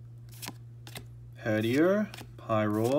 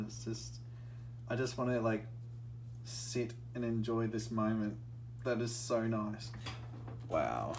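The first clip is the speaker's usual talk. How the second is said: hushed awe; 10 dB quieter than usual. Real, not acted. Savoring the moment.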